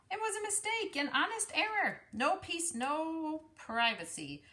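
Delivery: high fussy rabbit; huffy and put out. Acted. Rabbit character complains.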